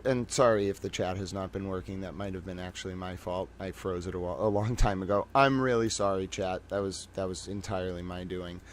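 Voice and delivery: softly apologizing, low and hushed; quiet. Real, not acted. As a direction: mumble your sorry to the chat. Apologizing to chat.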